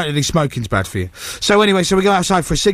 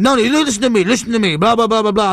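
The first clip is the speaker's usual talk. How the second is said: higher-pitched, insistent mock protest; imitated arguing guard; 6 semitones higher than usual. Guard cutting off an angry visitor, imitated insistence. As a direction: talk over them as the insistent guard.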